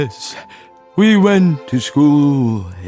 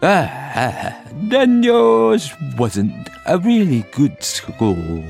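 slow, high sigh of relief; relieved turtle drawl; greatly relieved; 4 semitones higher, much slower than usual. Acted. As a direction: stretch it out with smug relief. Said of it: "in a tone of great relief".

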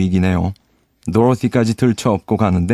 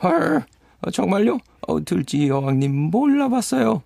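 surprised high voice; 9 semitones higher, 3 dB quieter than usual. Acted. Surprised reply.

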